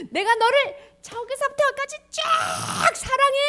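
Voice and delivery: high, loud, stretched-out voice; God's big voice. Acted. God telling people his love, grand voice. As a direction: sweep it wide and grand. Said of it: "이렇게 얘기하니까".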